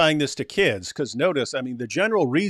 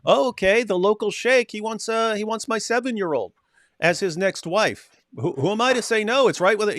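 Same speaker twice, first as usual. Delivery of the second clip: higher, meek voice of a parent talking themselves into it; a lightly mocking, pitched-up parent going along; mock conditioned parent; 6 semitones higher than usual. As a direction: play a naive parent shrugging off doubts. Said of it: "end up with".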